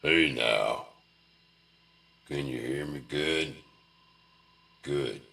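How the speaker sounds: low, drawling parent check-in; folksy dad voice. Retold or imitated. Talking to his kids in his odd voice. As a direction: ease into a deep country dad. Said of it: "every time you talk to them".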